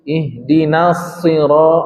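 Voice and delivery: Ihdinas shi- slowly, the shad thick; heavy shad. Shown as a demonstration. Teacher shows the thick shad to the class, slowly. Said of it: "Na-nya biasa aja".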